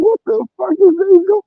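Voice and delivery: mock shocked squeal at the neighbours' grill; baffled what the fuck. Watching strangers barbecue at the park, high baffled squeal. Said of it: "You were like".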